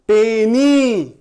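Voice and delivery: Penney very slow and loud, both syllables stretched. Shown as a demonstration. Teacher showing two syllables, Penney slowly drawn out. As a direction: draw out पे and नी firmly. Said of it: "दो syllable".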